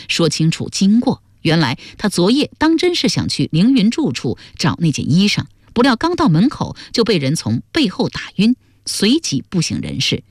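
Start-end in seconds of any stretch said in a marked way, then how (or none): none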